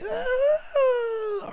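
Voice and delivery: high, drawn-out "ah" imitating a sitcom crowd; mock studio-audience reaction. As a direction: wail it like a TV audience. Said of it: "the audience be like".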